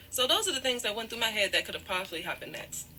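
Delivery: normal talking voice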